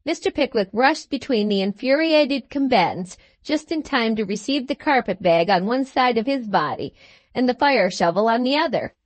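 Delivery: ordinary delivery, nothing marked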